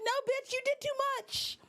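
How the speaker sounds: indignant verdict on her; sassy scolding. Retold or imitated. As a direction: scold her like a friend who messed up. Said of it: "I'm like".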